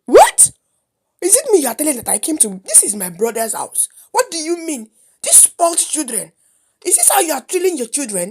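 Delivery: high, loud, hurried indignation; high-pitched angry scolding, starting on a yelp; outraged. Acted. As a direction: explode in offence as the insulted uncle. Uncle outraged at children's insult.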